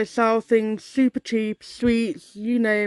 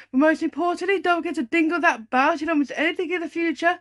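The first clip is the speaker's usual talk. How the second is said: excited; 6 semitones higher than usual. Acted. Upbeat channel outro call.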